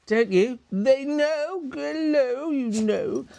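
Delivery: talking to her dogs about a walk, high and sing-song. Real, not acted. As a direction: coo at the excited dogs in a high voice. Excited talk to the dogs.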